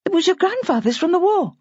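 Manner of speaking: put-on a young wife trying to reassure him; young woman Bridget. A narrator's reading. Bridget voiced by narrator.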